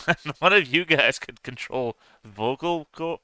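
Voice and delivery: normal talking voice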